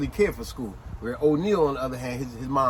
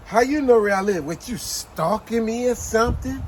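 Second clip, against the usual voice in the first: joking accusation; 6 semitones higher, 4 dB louder than usual. Acted. Girl teasing a boy who knows where she lives, joking accusation. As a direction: tease with a loud, incredulous challenge. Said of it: "Now, she was joking".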